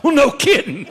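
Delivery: sarcastic comeback to the vet; sarcastic retort. Retold or imitated. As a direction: scoff at the vet's obvious remark. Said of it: "I'm like".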